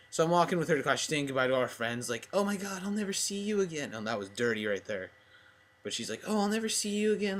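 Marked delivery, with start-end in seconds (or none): 2.3-3.9 high-pitched mock girly farewell; imitated girl's goodbye. 6.3-7.4 high-pitched mock girly farewell; imitated girl's goodbye. Repeats crush's goodbye.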